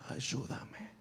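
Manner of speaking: breathless whisper; whispered plea. Acted. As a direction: barely voice the plea. Father's doubting whisper. (susurro suplicante)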